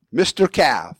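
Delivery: ordinary delivery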